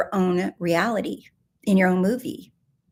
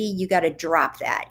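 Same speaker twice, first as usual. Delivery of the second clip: ordinary talk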